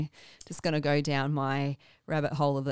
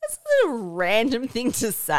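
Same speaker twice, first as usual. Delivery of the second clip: incredulous; 5 semitones higher, 6 dB louder than usual. Retold or imitated. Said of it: "I was just like".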